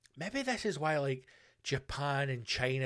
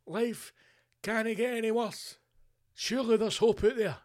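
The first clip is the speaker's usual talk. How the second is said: higher-pitched mock despair; voiced despairing Japanese people; 6 semitones higher than usual. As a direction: lament hammily, pitched up. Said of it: "And they're going".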